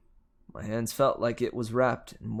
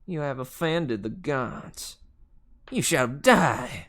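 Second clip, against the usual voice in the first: slow Southern drawl rising to a loud threat; Southern accent; 3 semitones higher, 4 dB louder, slower than usual. A narrator's reading. Fury teacher condemning the hero, Southern accent. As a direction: drawl the doom in Southern, punching the end loud. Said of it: "south than Georgia".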